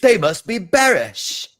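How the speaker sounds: slow, squeaky put-on British voice; joking British impression; mock British accent. Joining the mock British bit.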